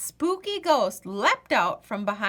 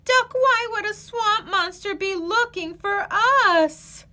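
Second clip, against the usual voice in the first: high, louder worried question; anxious Goose; 11 semitones higher, 5 dB louder than usual. Acted. Goose asks Duck in worry, high and anxious. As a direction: fret out loud.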